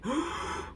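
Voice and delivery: quick high frightened gasp; sharp gasp. Retold or imitated. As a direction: gasp in shock as the plane drops. Plane suddenly drops.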